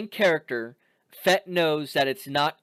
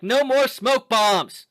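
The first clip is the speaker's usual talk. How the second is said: yelled back; 7 semitones higher, 4 dB louder, slower than usual. Retold or imitated. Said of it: "she yells back".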